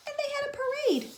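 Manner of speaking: very high and fast; gasping delight. Acted. Teacher showing the parade, breathless delight. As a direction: burst with joy. Gasp then rapid delight.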